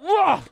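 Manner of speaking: high loud disgusted ugh; disgusted groan. Real, not acted. Grossed out by nail story.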